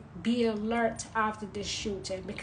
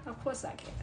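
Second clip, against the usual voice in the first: softer cheeky answer to self; hushed aside; 11 dB quieter, faster than usual. Real, not acted. Answering her own question again, hushed and sly. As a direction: drop it to a sly hush.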